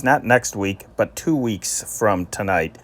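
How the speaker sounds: ordinary delivery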